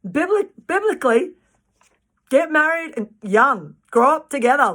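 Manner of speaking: ordinary talk, nothing marked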